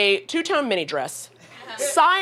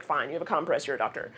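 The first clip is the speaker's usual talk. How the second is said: much softer and lower, sinking down; grudging concession; 7 semitones lower, 8 dB quieter, faster than usual. Retold or imitated. Herself grudgingly accepting the doctor, soft and low. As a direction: give in with a reluctant mumble.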